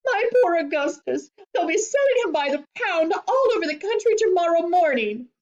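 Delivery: high, distraught wailing; screamed. A narrator's reading. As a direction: wail for your poor boy. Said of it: "screamed Missus Globe".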